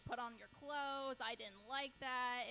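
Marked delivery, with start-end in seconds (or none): 0.0-1.2 slow, deliberate instruction; slow firm order. Retold or imitated. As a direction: spell it out slowly. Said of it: "I'm like".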